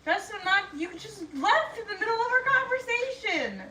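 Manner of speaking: high-pitched, laughing indignation; indignant protest. Real, not acted. Reader left mid-conversation by companion, indignantly protesting.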